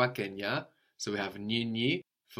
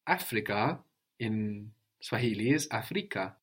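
ordinary delivery

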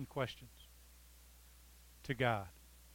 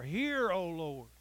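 "Hear" flung out high and loud, then "O Lord" settles; bold plea; 3 semitones higher, 5 dB louder, much slower than usual. Retold or imitated. Preacher showing the church how to pray boldly to God. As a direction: demand to be heard, boldly. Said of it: "With this boldness, with this confidence".

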